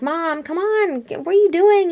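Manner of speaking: high, exasperated mock yell. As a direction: yell to your mom, holding back volume. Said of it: "yelling at her".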